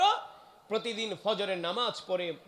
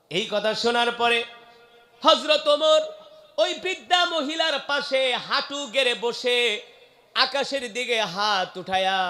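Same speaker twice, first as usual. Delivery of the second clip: raised, ringing sermon delivery; impassioned preaching; 5 semitones higher, 7 dB louder than usual. Acted. Preacher describing Omar kneeling to pray, impassioned and raised. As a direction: start high and loud, ease down gradually.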